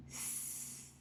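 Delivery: S as a long unvoiced hiss; hissing s sound. Shown as a demonstration. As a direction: hiss S through the teeth. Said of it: "put your tongue between your teeth and say".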